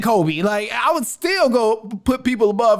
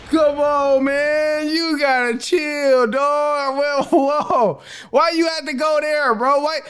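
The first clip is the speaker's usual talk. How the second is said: laughing through words; 8 semitones higher than usual. Real, not acted. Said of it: "You crazy for that".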